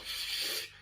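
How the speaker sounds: very soft, voiceless shush; a long quiet "shh" for silence; hushing shh. Acted. Dad silencing his son in the tent, soft shush.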